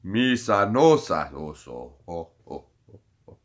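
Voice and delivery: low choking alien gibberish fading to gasps; low Huttese gibberish, fading into choked gasps; Jabba the Hutt choking. Retold or imitated. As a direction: gurgle out Jabba's last words. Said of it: "Jabba the Hutt when".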